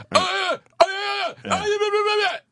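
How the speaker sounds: high strained samurai yelps; mock samurai grunts. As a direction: grunt and yelp like a comic samurai. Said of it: "dress up like a samurai".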